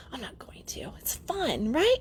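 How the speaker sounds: hushed playful whisper; whispered aside. Real, not acted. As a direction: let it slip out as a secret whisper.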